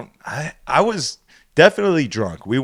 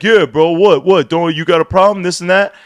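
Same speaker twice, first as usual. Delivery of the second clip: mocking imitation of a belligerent kid, louder; raised, pushy comeback; confrontational; 4 semitones higher, 5 dB louder than usual. Kid picking a fight with the DJ, confrontational. Kid argues back in retold quarrel.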